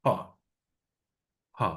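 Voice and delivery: ফা said as pha with a forceful puff of breath; ফা with a hard burst of air from the lips; aspirated pha. Shown as a demonstration. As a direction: blow a strong breath on ফা. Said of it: "অনেক জোর দিয়ে উচ্চারণ করব".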